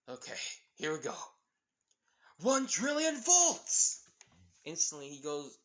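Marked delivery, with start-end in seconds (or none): 0.1-4.0 battle cry. Acted. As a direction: scream it as the volts surge. Kaminari powering the generator.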